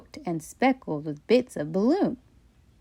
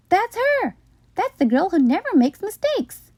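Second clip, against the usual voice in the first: excited woman; 10 semitones higher, 3 dB louder than usual. Acted. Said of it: "said a woman".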